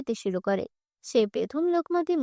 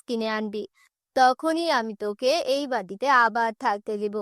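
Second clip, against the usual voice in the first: lower, stern voice laying down terms; cold stepmother; 3 semitones lower than usual. Acted. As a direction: dictate the harsh condition. Stepmother's condition.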